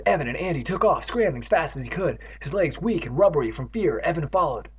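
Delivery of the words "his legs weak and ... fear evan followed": usual speaking voice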